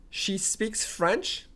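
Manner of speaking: slow model sentence; slow demo line; slow statement. Shown as a demonstration. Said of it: "That's how we would go".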